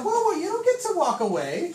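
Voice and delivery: high-pitched imitation of a woman; imitated woman's protest; mock calling back.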